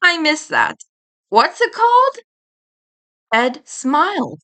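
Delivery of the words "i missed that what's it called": high, mock-innocent kid voice; teasing feigned confusion. Eddie pretending to mishear to tease Edd. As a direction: play dumb to mock him.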